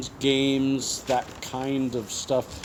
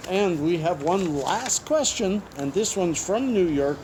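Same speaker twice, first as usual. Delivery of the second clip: ordinary delivery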